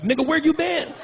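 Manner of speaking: high, joyful call; excited greeting. Retold or imitated. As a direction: greet a stranger like an old friend.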